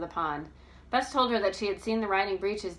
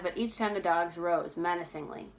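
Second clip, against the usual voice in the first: usual speaking voice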